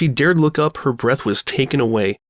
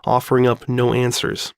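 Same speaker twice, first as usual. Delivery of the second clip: ordinary delivery, nothing marked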